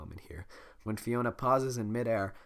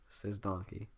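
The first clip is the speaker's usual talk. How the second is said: ordinary delivery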